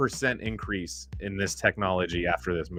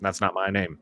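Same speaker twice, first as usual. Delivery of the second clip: ordinary delivery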